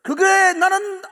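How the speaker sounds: loud, high, impassioned preaching; full-force preaching. Acted. Pastor pressing the congregation, full-force preaching. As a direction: preach to the climax, voice near tears.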